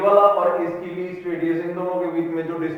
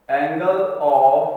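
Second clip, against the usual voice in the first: very slow, spaced-out words; dictation; 4 semitones lower, much slower than usual. Real, not acted. Teacher announcing the next question to write, slow dictation. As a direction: dictate it slowly for note-taking.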